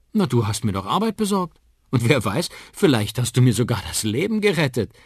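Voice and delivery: loud, high, lively praise; enthusiastic praise. Acted. Uncle explains why Liam is greatest, enthusiastic. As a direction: build the boy up with gusto.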